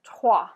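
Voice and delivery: loud "Trois" as "trwah", R from the throat; French "Trois", strong guttural R after the T. Shown as a demonstration. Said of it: "pronouncing that guttural R".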